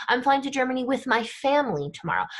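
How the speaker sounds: "family" accented, the rest quick; single out "family" with your voice; stress on family. Shown as a demonstration. Teacher demonstrating emphasis in English, stress on "family". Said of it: "we would use our voice".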